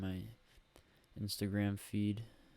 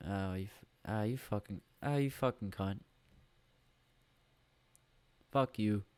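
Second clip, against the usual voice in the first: mock Bill Burr rant. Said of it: "That's what he says to me".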